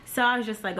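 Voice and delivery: usual speaking voice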